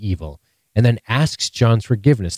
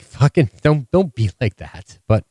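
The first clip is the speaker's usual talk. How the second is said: ordinary talk, nothing marked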